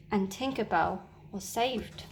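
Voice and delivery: ordinary delivery, nothing marked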